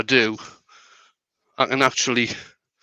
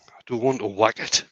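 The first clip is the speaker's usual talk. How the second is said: whispered; 5 dB quieter than usual. Real, not acted. Refusing to whack the lid.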